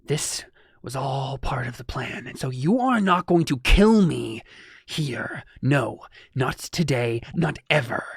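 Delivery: slow, deliberate villain threat; slow gloating villain voice; villain's gloating. Acted. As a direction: gloat that the hero cannot kill him.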